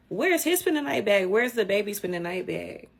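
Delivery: high, loud disbelief; incredulous. Real, not acted. Mocking search for the child.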